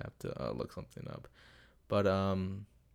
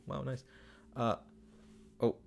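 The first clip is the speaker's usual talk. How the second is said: normal talking voice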